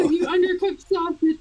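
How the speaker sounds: mock chef insult, shouted high; Gordon Ramsay. Chef as sat-nav insulting a driver, mock shout. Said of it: "Gordon Ramsay telling me how to drive".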